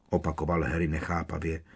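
ordinary delivery

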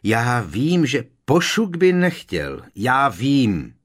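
loud, high-pitched outburst; thundering. A narrator's reading. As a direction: thunder it out in exasperation. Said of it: "zahřměl Harry".

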